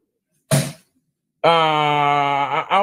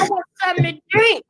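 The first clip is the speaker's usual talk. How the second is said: imitating a child; 12 semitones higher, faster than usual. Child voice in retold scene.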